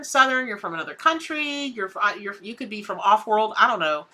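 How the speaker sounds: ordinary talk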